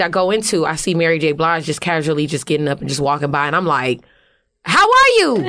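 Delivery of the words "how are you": high, loud, starstruck squeak; starstruck greeting. Retold or imitated. Starstruck rapper greeting [name], high squeaky awe. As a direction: blurt it out high and nervous.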